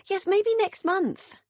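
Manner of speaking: high, slow, evasive sing-song; high hedging sister. A narrator's reading. Beth dodging Meg's invite, high and slow. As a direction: stall sweetly, promising nothing.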